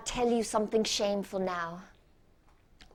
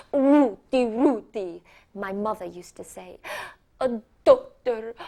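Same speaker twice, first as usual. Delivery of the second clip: a lightly voiced doting mother; proud mother; 7 dB louder than usual. Mother boasting about a doctor in the family, louder and gushing.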